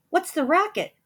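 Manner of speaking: high, startled call; startled cry. A narrator's reading. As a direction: call out from the kitchen. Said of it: "Cindy cried".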